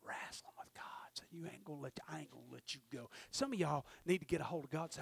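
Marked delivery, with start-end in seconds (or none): 0.0-3.1 hushed, breathy whisper. Acted. Preacher whispering Jacob's vow to the congregation. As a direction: draw them in with a whisper.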